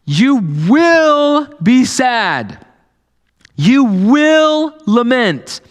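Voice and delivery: Jesus telling the disciples straight; Jesus speaking plainly. Retold or imitated. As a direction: spell out hard truth to friends. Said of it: "He's like".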